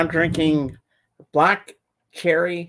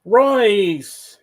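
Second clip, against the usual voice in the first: calling out a name; 6 semitones higher, 6 dB louder than usual. Real, not acted. Greeting a friend joining the stream.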